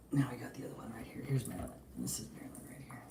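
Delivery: murmuring so as not to startle the pet; hushed intro. Real, not acted. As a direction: quietly present the pet. Showing the sleepy pet.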